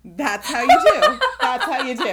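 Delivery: high, loud, fast, laughing through it. Pretend. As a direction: blurt it out laughing. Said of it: "you're like".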